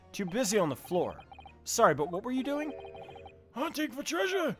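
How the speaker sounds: put-on old man voice; cackling excited old man. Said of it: "your old man voice".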